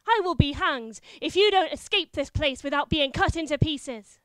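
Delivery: loud, commanding threat; wife disguised as a knight threatening her husband; terrifying voice. Acted. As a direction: roar it down at the cowering knight. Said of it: "in a terrifying voice".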